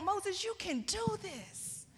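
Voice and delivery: high, soft, drawn-out cheerleading; mock pep talk. What God would not tell Moses, mock pep talk. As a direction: coo it slowly in a high mock-encouraging voice. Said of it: "does not give him a pep talk".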